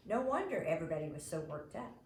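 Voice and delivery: ordinary delivery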